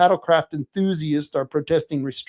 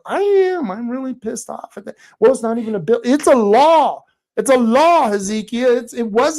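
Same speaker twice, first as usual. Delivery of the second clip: loud, higher, insistent correction; pissed off; 6 semitones higher, 5 dB louder than usual. Real, not acted. Answering a viewer's comment, loud and irritated. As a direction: hammer it home, louder and higher. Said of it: "You're really pissed off at that bill".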